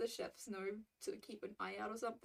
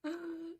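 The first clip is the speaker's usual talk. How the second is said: high-pitched laugh; delighted laugh; 8 semitones higher than usual. Real, not acted. Streamer amused by the retail prank, delighted laugh.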